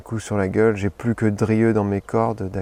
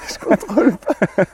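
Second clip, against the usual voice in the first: [name] impression; 9 semitones higher, 4 dB louder than usual. Shown as a demonstration. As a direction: still in the high pop-star voice.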